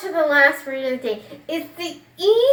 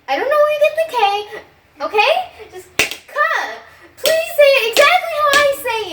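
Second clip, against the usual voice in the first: much higher, rising exasperation; exasperated teacher; 10 semitones higher, 3 dB louder, faster than usual. Acted. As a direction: lose your patience, pitch climbing. Frustrated teacher losing patience.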